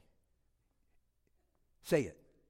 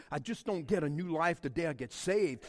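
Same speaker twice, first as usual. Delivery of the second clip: ordinary talk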